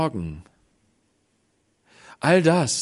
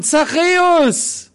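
long, slow, much higher loud call of the name; 8 dB louder, much slower than usual. Acted. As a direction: call him by name from below the tree. Jesus calls him by name.

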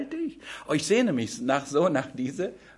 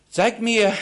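higher and louder, commanding; Jesus ordering the Pharisees, louder; firm command; 6 semitones higher, 7 dB louder than usual. Acted. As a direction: demand the coin firmly. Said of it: "Jesus demands the coin".

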